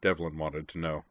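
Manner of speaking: ordinary delivery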